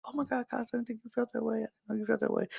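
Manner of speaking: high, soft mock-apologetic voice; mock dismay. People backpedalling to Casa, high soft dismay. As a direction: plead innocence in a small high voice.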